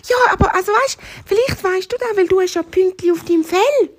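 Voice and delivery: high-pitched hare, pleading its case; insistent little hare. Acted. Hare reasons with the cheetah, high and insistent. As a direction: press on in a small high voice.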